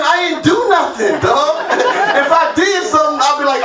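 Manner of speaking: usual speaking voice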